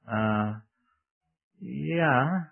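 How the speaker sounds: drawn-out, uncertain agreement; stall, then agree doubtfully; hesitant yes. Acted. Young woman still wanting the preacher's prayer, hesitant yes.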